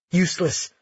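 ordinary delivery

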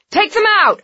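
high, loud frantic scream. A narrator's reading. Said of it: "a guard screamed".

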